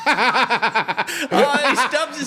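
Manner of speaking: loud, high laughing cry; laughing shout. Real, not acted. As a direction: howl with laughter. Laughing at friend's pain.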